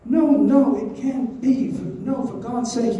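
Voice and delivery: high, agitated protest; acted desperate refusal; distressed denial. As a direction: push the news away, high and insistent. Dolly refuses to believe the accusation.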